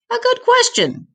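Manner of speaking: squeaky high official musing aloud; high prime minister voice. A narrator's reading. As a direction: pitch jumps way up for the prime minister. Prime minister answers in story.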